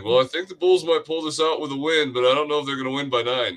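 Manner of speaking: a hint of a smug bettor, quickening at the end; lightly voiced commenter. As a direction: lightly mimic a know-it-all bettor.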